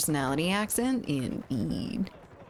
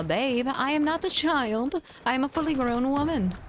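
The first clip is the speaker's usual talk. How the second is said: higher, mock-offended protest; hammed-up offended woman; mock indignation; 8 semitones higher than usual. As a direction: playfully insist you're a grown-up.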